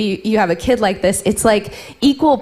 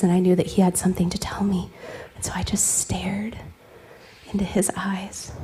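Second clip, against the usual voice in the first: whisper; 2 semitones lower, 8 dB quieter than usual. Real, not acted. Intimate bedtime moment, whispering.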